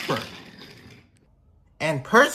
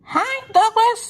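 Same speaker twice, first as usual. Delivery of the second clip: very high, light little-engine voice; high Rosie voice; 4 dB louder than usual. Acted. Rosie greeting Donald, mistaking him for Douglas, very high and light. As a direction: squeak it out like a small cheerful engine.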